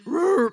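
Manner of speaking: loud, high mock Wookiee roar in reply; Chewbacca roar back. Chewbacca actor answering the husband, loud mock roar back. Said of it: "My husband roared at the Chewbacca guy".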